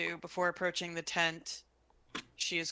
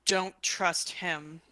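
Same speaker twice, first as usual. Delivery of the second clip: urgent message; 5 dB louder, slower than usual. Acted. Telepathic warning message to Roden.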